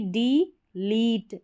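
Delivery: डी लीट said slowly in two parts; broken into syllables. Shown as a demonstration. Teacher sounding out the parts for students, slow. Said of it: "break करके पढ़ना".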